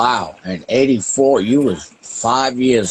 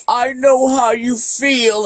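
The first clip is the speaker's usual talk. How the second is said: slower, higher-pitched mock sympathy; mocking quote; 8 semitones higher, slower than usual. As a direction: throw their empty sympathy back, drawn out and high. Mocks those offering false sympathy.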